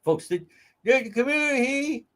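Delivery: high, exaggerated accent impression; mock Charlene Lima accent. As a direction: squeeze her accent out high for mockery. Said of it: "a translator".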